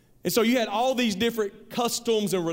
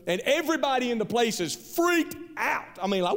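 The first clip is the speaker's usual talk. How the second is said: ordinary delivery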